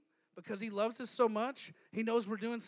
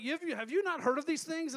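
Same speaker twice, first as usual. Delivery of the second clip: incredulous disciples; 6 semitones higher, 6 dB louder than usual. Retold or imitated. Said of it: "They're like".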